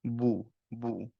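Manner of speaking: বু slow and soft with rounded lips. Shown as a demonstration. Teacher modelling বু with rounded lips. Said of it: "লিপসটা round হতে হবে".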